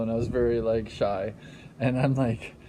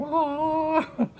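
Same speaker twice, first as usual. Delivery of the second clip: high, drawn-out "waah"; mock fighting wail; 14 semitones higher than usual. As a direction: imitate a brawl with one long high cry.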